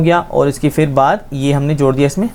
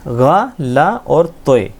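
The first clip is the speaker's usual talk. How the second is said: slow spelling; much slower than usual. Shown as a demonstration. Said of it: "देखिए".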